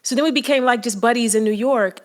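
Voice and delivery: ordinary talk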